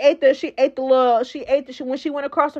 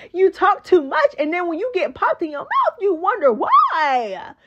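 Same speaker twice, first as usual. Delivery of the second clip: sing-song scolding with a stretched last word; mock scolding; 6 semitones higher than usual. Mock scolding the talkative girl.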